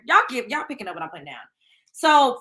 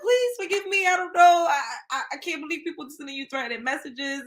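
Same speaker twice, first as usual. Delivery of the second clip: fake-sob a begging sorry to her sister; theatrical pretend crying, pleading for forgiveness; mock crying plea; 3 semitones higher than usual. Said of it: "I be crying".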